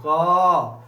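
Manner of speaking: long क ending in a held अ; loud, sustained क with its vowel ringing on; drawn-out letter sound. Shown as a demonstration. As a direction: stretch क to show the अ behind it. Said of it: "देखिए बोल के देखिए".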